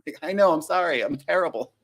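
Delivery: ordinary delivery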